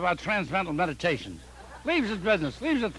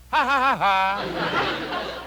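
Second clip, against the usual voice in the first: mocking laugh; much slower than usual.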